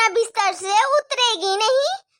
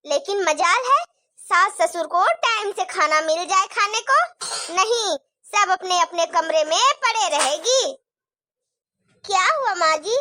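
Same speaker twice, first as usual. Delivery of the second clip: ordinary talk, nothing marked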